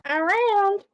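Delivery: a light mock country twang on Around, stretched and high; a hint of a Southern country drawl on Around. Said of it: "that fake country, like, twang".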